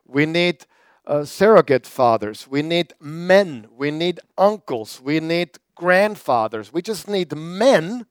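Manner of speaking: ordinary talk, nothing marked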